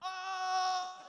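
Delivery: high cackling yelp; high mock yelp. Comic acting out dodging bullets with a high yelp.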